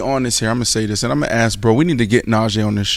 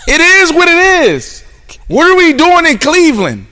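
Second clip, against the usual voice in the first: much higher and louder, ranting hard; excited rant; 11 semitones higher, 4 dB louder than usual. Real, not acted. Host ranting to co-hosts about Cleveland, high and loud. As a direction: go off like you can't stand Cleveland. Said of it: "why am I talking like this?".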